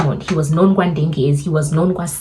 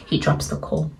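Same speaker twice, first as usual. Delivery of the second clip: usual speaking voice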